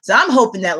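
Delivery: usual speaking voice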